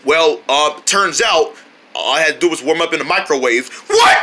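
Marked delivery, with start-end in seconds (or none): 3.9-4.2 high, loud "what"; shocked outburst. Acted. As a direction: yelp it in disbelief. Shocked that cake was microwaved.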